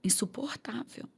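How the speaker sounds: quase sem voz, num sopro; sussurrado. Real, not acted. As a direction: whisper it in disbelief. Desdém dito em sopro. (sussurrado, quase sem voz)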